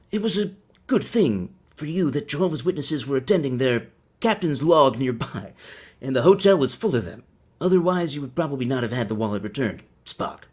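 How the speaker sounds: hammed-up captain addressing his officer, odd pauses; Captain Kirk. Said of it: "more committed to being Kirk".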